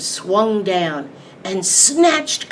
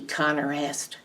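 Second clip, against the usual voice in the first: ordinary delivery, nothing marked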